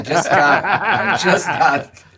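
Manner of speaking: laughing through the words. Real, not acted. Teammate laughing about his own height. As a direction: chuckle as you admit it.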